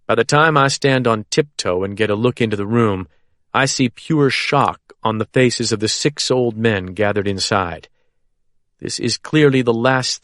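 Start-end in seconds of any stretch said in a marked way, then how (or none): none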